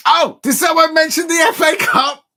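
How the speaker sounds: high, loud mock surprise; gleeful shout. Real, not acted. Fan teasing rivals about the cup, gleeful shouting. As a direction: crow it loudly like a taunt. Crowing before a football chant.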